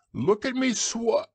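very high, proud squeal, cut off; squeaky Roo. A narrator's reading. Roo boasts as the waterfall takes Roo, squeaking high. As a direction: squeak it until cut short. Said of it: "squeaked Roo".

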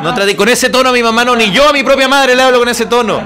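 loud, high protest played for laughs; hammed-up scolding; mock indignation. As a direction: protest at full voice in mock outrage.

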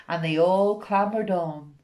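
ordinary delivery

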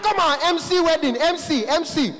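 high, loud, insistent repeating; poor man. Retold or imitated. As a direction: shout it high for someone who didn't understand. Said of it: "voice is always very high".

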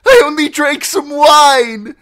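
high, loud frantic argument; desperate pleading. Acted. Captive demanding trial from outlaws, desperate pleading. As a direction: argue wildly for your life.

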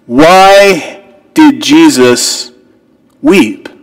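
"Why did Jesus weep?" stretched out very slowly, falling on "weep"; slow the question right down and let it hang; dramatic question. Acted. Preacher posing the sermon's question to the congregation, very slow.

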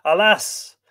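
loud, high anguished alas; a cry of deep pain; grieving groan. Acted. Wounded Fionn hears of his lost wife, anguished groan. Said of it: "groaned deep-wounded Fionn".

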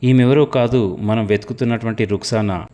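plain reading voice

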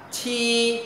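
"chi" with a puff of air, held high and level; aspirated sound. Shown as a demonstration. Teacher introduces the hard-to-read letter, aspirated sound. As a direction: push air through the teeth on "chi". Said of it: "âm hơi gió bật hơi".

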